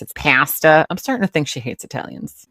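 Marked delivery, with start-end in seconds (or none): 0.1-0.8 loud "pasta" as PASS-tuh; mock "pasta" with a flat short A; "pasta" with a loud cat-like A; deliberate mispronunciation. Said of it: "mispronounce on purpose".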